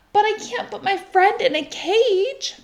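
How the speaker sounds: put-on high, upset boy's protest; a child pleading against the cage; boy crying out. Lewis begs his parents, high and upset. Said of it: "cried Lewis".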